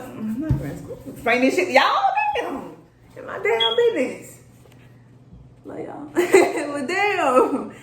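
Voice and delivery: high, giggling protest; laughing. Real, not acted. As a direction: laugh through the words in embarrassment.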